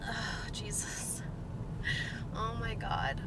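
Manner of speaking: whispered exasperated groan; soft, breath-only exasperation. Real, not acted. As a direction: sigh it out under your breath, fed up. Exasperated after ranting about commenters.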